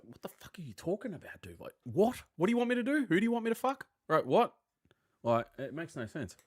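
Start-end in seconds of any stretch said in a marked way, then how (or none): 0.0-4.5 much higher, baffled and fed up; disbelieving outburst at office jargon; incredulous rant. Real, not acted. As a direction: throw up your hands at nonsense jargon. Ranting at coworker jargon.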